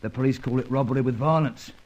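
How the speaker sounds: knowing Cockney, matter-of-fact; London Cockney talking shop; Cockney accent. Acted. Old crook explaining villains to his friend, in Cockney.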